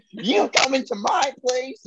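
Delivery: hammed-up high scolding voice, drawn out; mock angry woman. Retelling woman confronting intruder.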